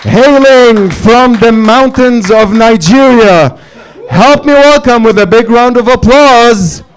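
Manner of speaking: big stage-host announcement; announcer voice. Acted. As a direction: hype up the crowd like a ring announcer. Host announcing next comic.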